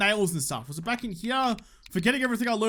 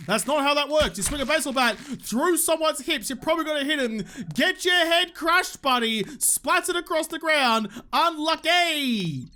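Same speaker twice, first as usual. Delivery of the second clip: heated trash talk; 6 semitones higher, 3 dB louder than usual. Real, not acted. Gamer batting a zombie's head, rowdy high-pitched taunting. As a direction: build from indignant rant to crowing taunt.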